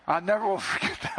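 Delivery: laughing through the words at a fond memory. Real, not acted. Pastor laughing to congregation at his laptop story, laughing. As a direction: chuckle it out, real amusement.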